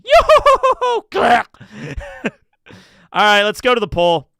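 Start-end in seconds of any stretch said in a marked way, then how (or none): 0.0-1.1 very high, loud sing-song whooping; goofy falsetto whoop. Pretend. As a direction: whoop it up like a cartoon victory cry. Silly celebration noise mid-stream.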